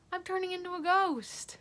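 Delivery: high, loud joking exclaim; loud mock-spooky aside; playful outburst. Real, not acted. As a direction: blurt it out as a goofy joke. Joking about looking pale.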